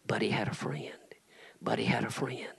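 breathy whispered repetition. Acted. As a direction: hush it like a secret. Repeating the tombstone line, hushed.

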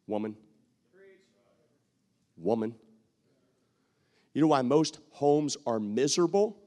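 ordinary delivery

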